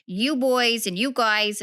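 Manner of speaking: fast, brassy New York gangster moll accent, lightly hammed. Recalling her stage role Bonnie the Gangsta, quick New York gangster accent. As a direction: rattle it off as a tough New York gangster girl. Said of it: "I talked like this the whole time".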